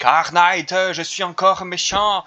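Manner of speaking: voix de méchant aiguë et forte, traînante; méchant caricatural. Retold or imitated. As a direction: singe le méchant de série de base. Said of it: "tu vois le méchant classique". (imitation moqueuse de méchant)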